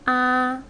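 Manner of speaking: "A" high and level; first tone. Shown as a demonstration. Teacher demonstrating four tones, high flat "A". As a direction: hold "A" on a steady high note. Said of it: "four tones".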